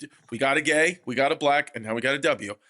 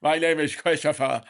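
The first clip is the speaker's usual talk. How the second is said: Vito. Retold or imitated. Retelling his awkward introduction to a woman, mimicking his friend. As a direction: introduce yourself haltingly in a mock odd voice. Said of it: "sounded like Vito".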